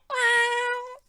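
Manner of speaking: high squeaky meow to the camera; cat meow. Retold or imitated. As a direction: play a cat, high and cute. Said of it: "they go".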